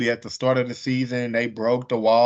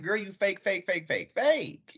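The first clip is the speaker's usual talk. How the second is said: mocking Marlo; 7 semitones higher, 5 dB quieter than usual. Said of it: "Marlo being like".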